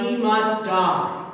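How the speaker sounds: slow, loud verdict; crying out. A narrator's reading. Sanhedrin condemning Jesus to death, slow cry. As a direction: cry out the sentence, slow and heavy. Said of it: "they cried".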